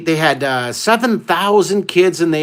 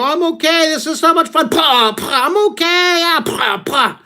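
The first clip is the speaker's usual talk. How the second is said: high, put-on brave voice between bumps; mock cheerful; 11 semitones higher than usual. Teenage dolphins pummel him, high mock cheerful. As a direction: insist you're fine while getting whacked. Getting hit by young dolphins.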